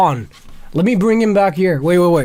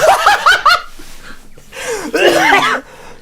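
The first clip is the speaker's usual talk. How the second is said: loud high laugh; 4 dB louder than usual. Real, not acted. After Takashi says line.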